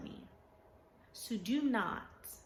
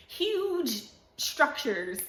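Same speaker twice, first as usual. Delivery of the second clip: laughing through huge structures, slowly and higher; 6 semitones higher, 6 dB louder, much slower than usual. Real, not acted. Praising ants, she laughs through the words.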